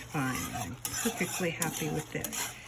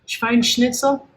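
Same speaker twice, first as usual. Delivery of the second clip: mock German accent; 10 dB louder than usual. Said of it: "improve my German accent".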